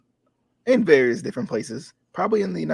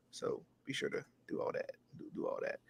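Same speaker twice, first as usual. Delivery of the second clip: whispered; 19 dB quieter than usual. Real, not acted. Trailing whispered sign-off.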